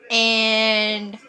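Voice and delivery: very slowly drawn-out, unbothered; dismissive drawl. Retold or imitated. Retold dismissive reply to insults.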